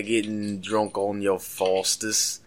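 put-on slow, drawn-out Aussie accent; put-on Australian drawl; mock Australian accent. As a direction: draw it out like an Aussie. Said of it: "let's get some Aussie lingo".

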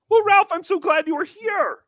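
high-pitched, delighted gush; gushing welcome. Acted. Host welcomes Ralph after the wiring trouble, gushing. Host gushes over guest's arrival.

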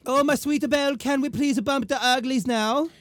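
high, put-on lovestruck voice; mock eager suitor. Suitor propositions his sweetheart, mock eager.

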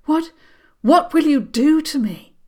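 high, loud, stammering panic; panicked. Acted. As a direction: blurt it out in terror. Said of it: "in a panic".

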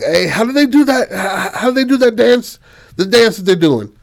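high-pitched, hesitant put-on voice; mayor character. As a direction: fumble eagerly for the name of a dance. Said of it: "the mayor comes up to her".

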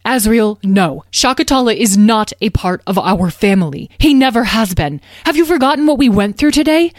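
agitated mother, high and loud, insistent; panicky. A narrator's reading. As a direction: forbid it urgently, breaking off mid-thought. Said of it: "Beatrice's face looked panicky".

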